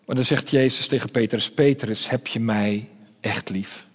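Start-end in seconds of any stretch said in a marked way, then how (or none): 1.5-3.9 quiet, slow question full of hurt from betrayal; pained. A narrator's reading. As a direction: ask it softly and slowly, carrying the pain of betrayal. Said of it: "alle pijn van het verraad klinkt erin door".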